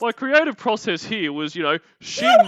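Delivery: pompous parody that leaps high and loud at the end; mock his artsy commentary; mock pretentious creator. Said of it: "sitting there going".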